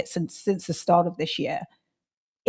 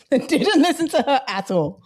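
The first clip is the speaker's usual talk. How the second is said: higher, slower, laughing through the words; rueful laughing; 4 semitones higher than usual. Real, not acted. Admitting she ignored her friend's warning, rueful laughing. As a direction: laugh at yourself as you admit it.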